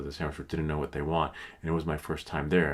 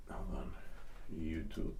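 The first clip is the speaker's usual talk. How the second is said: whispered aside; 3 semitones lower, 11 dB quieter than usual. Real, not acted. Muttering to himself while searching YouTube, whispered. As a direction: breathe it off-mic.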